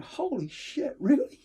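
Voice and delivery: jaw-drop disbelief; retold shock at a real tank; amazed. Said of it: "And I remember going".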